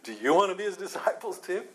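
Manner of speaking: sarcastic mock invitation. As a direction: ask it with open sarcasm. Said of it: "he said it sarcastically".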